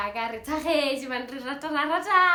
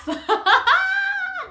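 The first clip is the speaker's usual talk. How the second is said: high, loud burst of laughing; burst of laughter; 10 dB louder than usual. Real, not acted. Teasing her listeners about jealousy, bursting into laughter. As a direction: laugh at your own joke.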